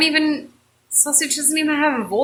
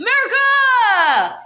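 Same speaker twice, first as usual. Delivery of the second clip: slow, louder cry of the name; patriotic shout; 11 semitones higher, 4 dB louder, much slower than usual. Acted. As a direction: bellow it like a rally chant. Sausage cheers in skit.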